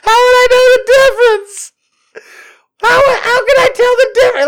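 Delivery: very high, loud mock-crying squeal; hammed-up panic, near tears; panicked wail. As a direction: wail helplessly in falsetto. Acting out worried self-doubt.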